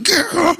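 high hammed-up battle yell; mock battle cry. Father imitating his son throwing his sister down, high battle cry. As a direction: let out a kid's fighting cry. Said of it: "him just being like".

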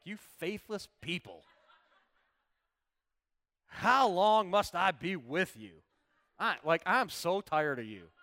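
loud, drawn-out scolding at the end of your patience; exasperated rebuke. Acted. Jesus to faithless people as the preacher hears it, exasperated. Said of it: "tried to read it with compassion".

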